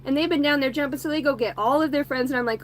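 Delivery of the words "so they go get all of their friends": plain reading voice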